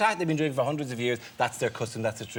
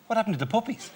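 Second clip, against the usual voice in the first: high mock kid voice; 10 semitones higher, faster than usual. Kids complaining about puppies.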